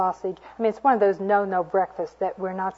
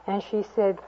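normal talking voice

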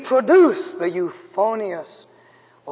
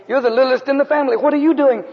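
rapid retold questioning, climbing higher; mother's tearful astonishment; 7 semitones higher, much faster than usual. Preacher imitating his weeping mother questioning her youngest, astonished. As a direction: voice a mother's rush of surprise, rising as it goes. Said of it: "a new burst of tears".

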